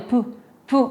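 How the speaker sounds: "P" as a bare puff of air; repeated "P" sound with a pause between puffs; voiceless P sound. Shown as a demonstration. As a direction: pop "P" on the lips without voice. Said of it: "Acá sucede el sonido, no las cuerdas vocales".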